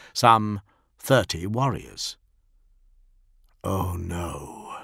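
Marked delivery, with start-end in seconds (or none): 3.6-4.8 softer, low and stunned; a captain realising his terrible mistake, quiet; hushed dread. A narrator's reading. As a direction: breathe it out as the truth lands. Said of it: "whispered Solomon".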